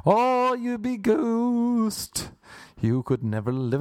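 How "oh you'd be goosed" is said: stretched mock horror. Drawn-out punchline.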